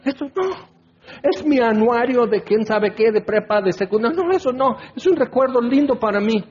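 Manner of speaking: high-pitched, mock-pleading objection; pleading protest. Person begging God not to throw out an old yearbook, pleading protest. Believer protests clearing of keepsakes.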